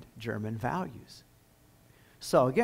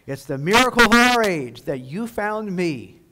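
proclaiming; 5 semitones higher, 10 dB louder than usual. Retold or imitated. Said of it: "Hitler proclaimed".

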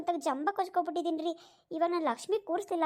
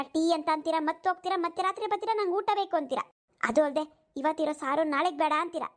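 usual speaking voice

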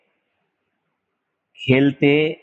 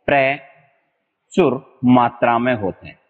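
प्रचुर मात्रा में drawn out slowly, मात्रा stressed; teacher emphasis; slower than usual. Shown as a demonstration. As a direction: spell it out slowly for students. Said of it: "teacher stresses the meaning". (मात्रा पर ज़ोर, धीमी गति)

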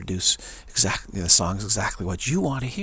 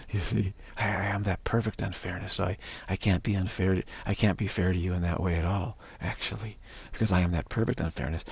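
ordinary talk, nothing marked

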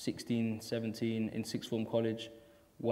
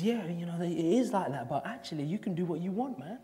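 beatnik teacher voice; 6 semitones higher than usual. Retold or imitated. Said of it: "And he said".

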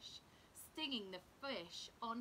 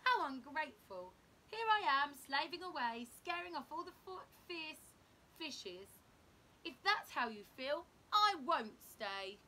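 louder, indignant complaint; offended huff; 5 semitones higher, 9 dB louder than usual. A narrator's reading. Blob snapping back at the crab, loud and indignant. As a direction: storm off in a huff. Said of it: "blob offended".

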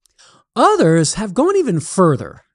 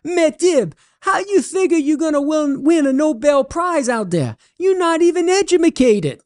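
much higher, doubting Cajun voice; Cajun holler; 12 semitones higher than usual. Acted. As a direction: call back high and skeptical in a Cajun accent. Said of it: "and he hollers".

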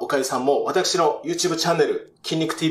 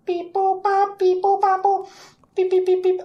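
robotic katakana speech; faster than usual. Acted. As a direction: chant it like a machine. Computer sound effect bit.

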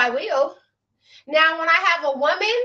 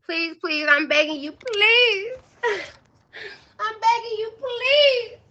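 higher, whiny fake-crying please; mock begging; 5 semitones higher, slower than usual. Streamer mock-begging Layla with higher, whiny fake tears. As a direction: ham up a tearful plea.

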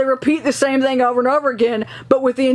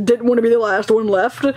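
ordinary talk

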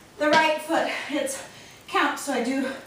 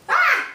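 surprised yelp; 11 semitones higher, 5 dB louder than usual. Real, not acted. Mom reacts to thrusters again.

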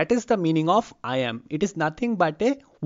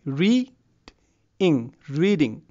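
"reading" broken into "read" and "ing", long pause between; syllable by syllable. Shown as a demonstration. Teacher showing two syllables in reading, split apart. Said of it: "reading has two syllables".

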